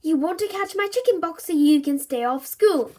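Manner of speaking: loud accusation; accusing sister. A narrator's reading. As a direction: accuse him outright. Susie accusing her brother.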